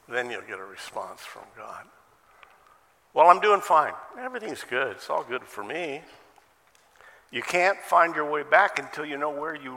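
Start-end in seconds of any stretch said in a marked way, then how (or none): 3.1-6.0 light, higher-pitched brush-off; mock upbeat self-assurance; breezy denial. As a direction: insist that all is well, starting loud and high. Acted upbeat reply to God's question.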